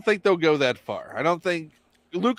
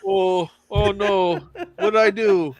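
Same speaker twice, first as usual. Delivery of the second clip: put-on slow, higher Canadian accent; 4 semitones higher, 4 dB louder, slower than usual. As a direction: play it Canadian and sheepish. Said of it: "A s-, slight Canadian accent".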